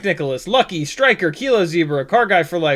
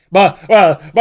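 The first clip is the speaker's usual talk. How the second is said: tongue-tied; 2 semitones higher, 10 dB louder than usual. Real, not acted. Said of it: "I can't speak".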